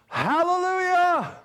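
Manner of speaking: high, drawn-out praise shout; exultant cry. Real, not acted. Preacher rejoicing over a saved marriage, exultant cry. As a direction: ring it out long and high.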